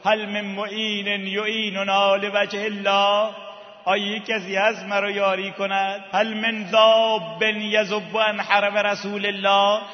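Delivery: ringing, chant-like plea for aid. Retold or imitated. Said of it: "صداش بلند شد".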